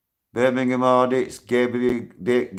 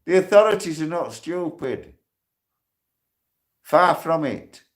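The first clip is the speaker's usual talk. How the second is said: angry rant; 6 semitones higher than usual. Real, not acted. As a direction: tell them off, voice rising hard. Ranting at critics in prison.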